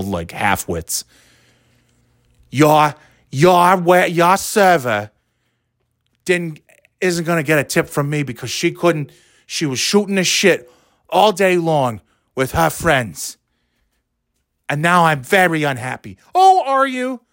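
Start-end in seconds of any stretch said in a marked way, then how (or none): 2.5-16.2 mocking New England accent. Rude customer complaining about the server, mocking New England accent. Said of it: "with that stupid New England accent". 16.3-17.2 high, sarcastic fake sympathy; mock concern. Answering the unhappy customer, mock concern.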